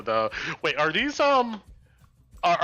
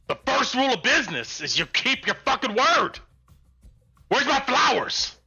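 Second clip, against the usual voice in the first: gruff, higher-pitched goblin boss; gravelly sly hobgoblin; 8 semitones higher than usual. Acted. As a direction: snarl it like a greedy goblin crime boss. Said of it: "You hear a gruff voice".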